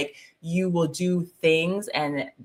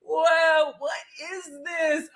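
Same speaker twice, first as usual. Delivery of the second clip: high, amazed whoa; high amazed wonder at the moment; awestruck wonder; 11 semitones higher, much slower than usual. Retold or imitated. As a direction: recall the shock of wonder, pitched up. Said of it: "I was just like".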